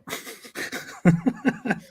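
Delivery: breathy helpless laugh; burst of laughter. Real, not acted. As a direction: crack up laughing. Laughing at "simping.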